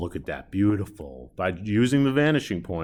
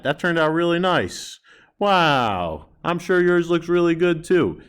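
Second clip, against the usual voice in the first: delighted; 8 semitones higher, 5 dB louder than usual. Real, not acted. Teacher delighted with drawing.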